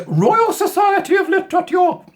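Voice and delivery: high, loud plummy toff voice; hammed-up upper-class mockery; mock posh. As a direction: announce it like a pompous aristocrat. Mocking posh institution name.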